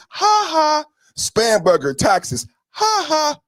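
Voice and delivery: high jeering ha ha; taunting laugh; mock laugh. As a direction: laugh in their face. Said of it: "we laugh at them in their face".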